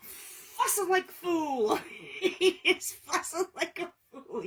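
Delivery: high squeaky laughing voice. Real, not acted. Cat hollering, she laughs.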